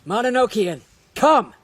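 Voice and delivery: loud, rising summoning cry; commanding call. Acted. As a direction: call it forth with authority. Summoning the Mononokean.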